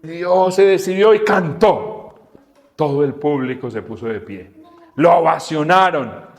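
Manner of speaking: ordinary talk, nothing marked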